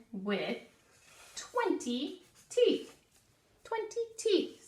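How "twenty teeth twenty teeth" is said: high, slow, sing-song twenty teeth; emphatic high. Shown as a demonstration. Teacher to first graders, a high emphatic twenty teeth. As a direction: make the number pop. Teacher stresses key fact.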